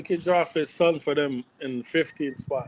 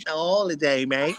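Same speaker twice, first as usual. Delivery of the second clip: hammed-up British "ain't it, mate"; mock British accent; 3 semitones higher than usual. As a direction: tease them in a cockney accent. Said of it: "dumb British accent".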